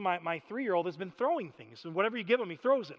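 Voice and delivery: ordinary talk, nothing marked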